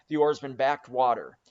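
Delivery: ordinary delivery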